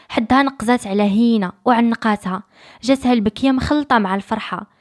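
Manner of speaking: ordinary delivery, nothing marked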